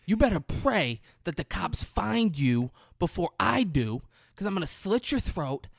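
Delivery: high, building to a shouted threat; hammed-up rage that climbs to a scream; retold screaming threat. Ben Foster screaming threats at Johnny down the phone. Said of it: "He's just screaming".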